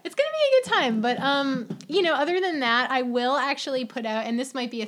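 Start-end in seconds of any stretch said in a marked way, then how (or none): 0.0-1.0 much higher and louder, gleeful; excited. Real, not acted. Host hyping a good time to listeners. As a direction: sell the fun. Promising party fun.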